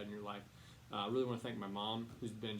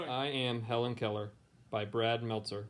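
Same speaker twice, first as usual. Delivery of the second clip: clear, louder storybook reading voice; reading to children; 10 dB louder than usual. A narrator's reading. Teacher reading a picture book to her students, storybook voice. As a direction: read it aloud to a class of kids.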